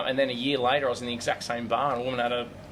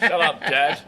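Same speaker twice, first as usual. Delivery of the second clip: laughing "shut up, Dad", a bit louder; laughing retort; 3 semitones higher, 6 dB louder, much slower than usual. Acted. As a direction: tease him back through a laugh. Mock retort to older comic.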